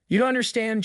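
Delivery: condescending, pitched high and falling; mildly patronising put-down. A narrator's reading. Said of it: "Gerald said condescendingly".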